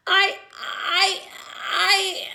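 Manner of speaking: high, halting stammer. A narrator's reading. Boy caught by mom, stammering nervously. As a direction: stumble on the first word, caught out. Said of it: "I stammered".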